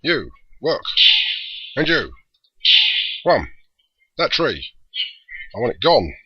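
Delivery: booming overlord barking orders at minions; loud commanding villain voice; big deep voice. Acted. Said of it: "big, deep voice".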